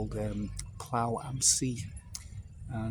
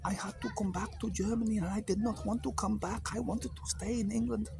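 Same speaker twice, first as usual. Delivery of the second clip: a hint of an old German accent, higher; lightly voicing the old German, higher; old German man; 10 semitones higher than usual. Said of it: "He said".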